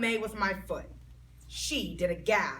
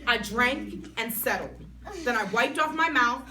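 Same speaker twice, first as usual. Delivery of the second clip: usual speaking voice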